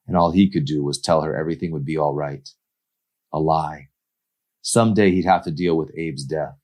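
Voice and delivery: plain reading voice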